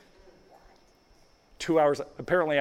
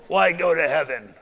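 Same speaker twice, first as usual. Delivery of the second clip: loud, put-on drunken certainty; imitated drunk; 5 dB louder than usual. Drunk answering what follows death, in a loud mock-drunk voice. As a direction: answer loudly as the cocksure drunk. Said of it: "just red-faced drunk".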